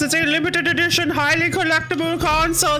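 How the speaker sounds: high, insistent correction; fussy visitor voice. Retold or imitated. Visitor insisting on his console's value, high offended tone. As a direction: correct them with offended pride, pitched high.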